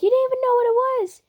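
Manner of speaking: high, loud, rapid protest; incredulous retort. Acted. Retort in a kissing argument.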